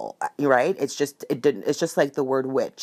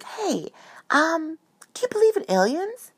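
high bright asking voice; 13 semitones higher than usual. Retold or imitated. Asking dad about aliens.

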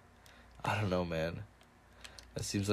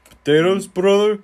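loud, high-pitched restaurant boss; boss impression; 11 semitones higher, 13 dB louder than usual. Co-host imitating the sandwich shop boss, loud high impression. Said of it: "pretty good impression of the boss".

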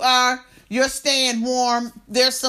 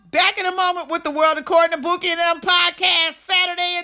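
high, upbeat break announcement; radio announcer; 6 semitones higher, 3 dB louder than usual. Acted. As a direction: sell the coming segment like a DJ.

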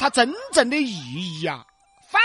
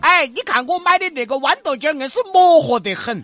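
put-on higher, louder chatty old auntie; old granny voice; 4 semitones higher, 5 dB louder than usual. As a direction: play a loud Guang'an granny. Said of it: "两个广安老妹儿们儿".

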